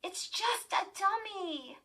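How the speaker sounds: high, slow dismissive call. A narrator's reading. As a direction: call down, unconvinced. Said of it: "Lindy called down".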